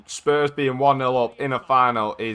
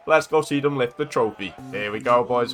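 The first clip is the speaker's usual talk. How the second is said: ordinary talk, nothing marked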